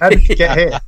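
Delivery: loud laughing disbelief. Real, not acted. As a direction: laugh it out loudly. Laughing at own podcast milestone.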